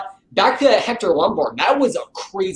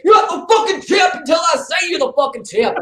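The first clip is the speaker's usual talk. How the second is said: a much higher, shouted threat, imitating the fighter; furious shout; 10 semitones higher than usual. Retelling the fighter taunting his rival after snatching the belt, shouting. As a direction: yell it like a fighter taunting at a press conference. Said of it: "and he's like".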